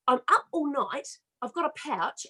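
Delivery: normal talking voice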